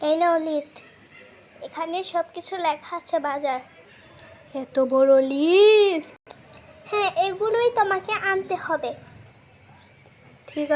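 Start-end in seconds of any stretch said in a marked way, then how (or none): 4.6-6.1 slow, loud, drawn-out disbelief; shocked. Acted. Father shocked by the wife's long shopping list. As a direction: stretch it out, aghast.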